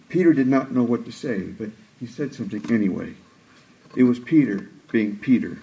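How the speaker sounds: ordinary talk, nothing marked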